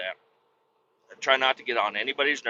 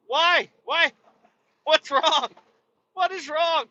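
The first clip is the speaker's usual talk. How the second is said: mock incredulous; 14 semitones higher, slower than usual. Mock questioning them.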